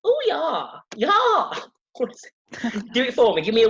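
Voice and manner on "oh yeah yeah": high, hammed-up German accent; high-pitched comic German voice; attempted German accent. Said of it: "attempt a German accent here".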